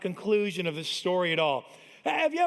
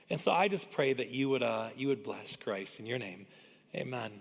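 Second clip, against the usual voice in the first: hushed pastor's prayer; 4 semitones lower, 4 dB quieter than usual. Acted. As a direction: pray it gently, as if heads are bowed. Leads a prayer.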